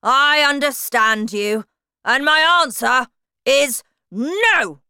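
a hulking tyrant praising her friend; bullying headmistress. A narrator's reading. Trunchbull voiced in story.

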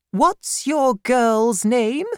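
put-on high, slow, imperious old lady voice; a severe aunt demanding at the door; stern old aunt. Aunt Betsy questions the frightened widow, high and slow.

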